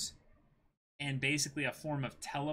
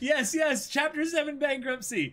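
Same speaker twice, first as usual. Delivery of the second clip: shrill excited; 13 semitones higher, 6 dB louder than usual. Real, not acted. Hysterical laughing mock glee.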